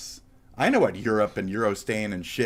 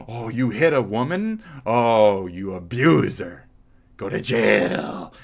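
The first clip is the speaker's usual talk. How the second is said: loud, drawn-out fake shock; mock outrage; 6 dB louder, slower than usual. Mocking the accusers of the man, hammed-up outrage. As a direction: ham up a scandalised accuser.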